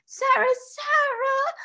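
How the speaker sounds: long, very high wailed call; child's wail. A narrator's reading. Hurt child calls for her protector, wailing. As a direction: wail out for help, drawn out. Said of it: "wailed Lottie".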